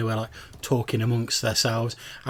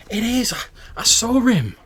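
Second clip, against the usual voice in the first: put-on much higher, pushed girlish protest; insistent young woman; 13 semitones higher, 8 dB louder than usual. First woman insists to her doubting friend, high and emphatic. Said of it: "And she's like".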